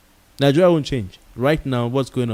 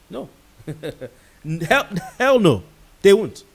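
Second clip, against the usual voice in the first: laughing hell no; 6 semitones higher than usual. Real, not acted. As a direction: burst out with an amused hell no. Emphatic denial with laugh.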